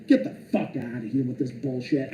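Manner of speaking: normal talking voice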